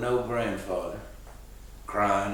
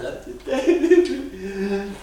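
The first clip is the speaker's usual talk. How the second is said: laughing; 8 semitones higher, 5 dB louder than usual. Real, not acted. Laughing through the retelling.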